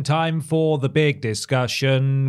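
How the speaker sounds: drawn-out, slow show announcement; slow announcer voice. Acted. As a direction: stretch each word like a host.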